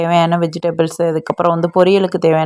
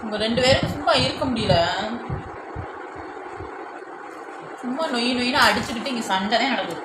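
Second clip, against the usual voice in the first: exasperated; 6 semitones higher, 10 dB quieter than usual. Real, not acted. Mother exasperated at fighting kids.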